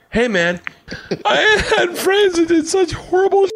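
high, laughing impression of an old pal; mock old buddy. Old friend mocked through laughter about past crimes.

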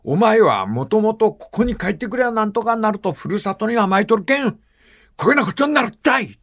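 hint of a stern Kyushu father, voice getting choked; father lecturing in Kyushu dialect. Retelling a film, the father lectures his daughter in Kyushu dialect with a catch of emotion. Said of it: "わかるか、九州弁".

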